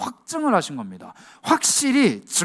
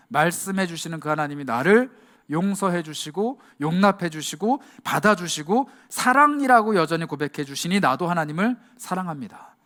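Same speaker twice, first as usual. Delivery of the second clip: ordinary talk, nothing marked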